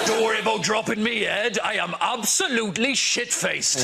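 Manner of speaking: a hint of a sloshed Welsh uncle, slowed down; drunk uncle. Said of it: "This is Uncle Toby".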